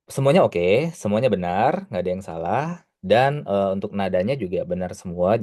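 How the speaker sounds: ordinary talk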